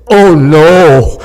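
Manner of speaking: a loud alarmed "Oh no"; dismayed "oh no". Retold or imitated. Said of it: "He said".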